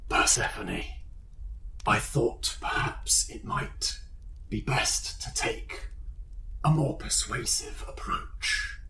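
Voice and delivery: slow, breathy demonic whisper. Acted. Possessed Toby speaks into Persephone's mind as a whispering demon. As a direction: hiss it inside her head. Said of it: "He speaks, ... in your head".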